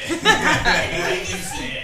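slow, laughing Scottish echo. Acted. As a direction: laugh through the line. Repeats line laughing, slower.

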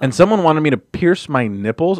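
ordinary talk, nothing marked

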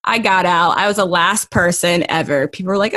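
usual speaking voice

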